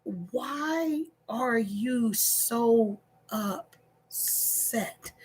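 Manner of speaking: slow, soft calming question; calm him down gently; soothing. Retold or imitated. Wife calming her upset husband.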